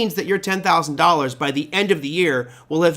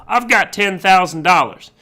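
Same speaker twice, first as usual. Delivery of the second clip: higher, louder put-on student voice; student Bob; 4 semitones higher, 5 dB louder than usual. Instructor voicing a student stating his account size, loud put-on voice. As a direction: announce it loudly as the naive student. Acting Bob's answer about his capital.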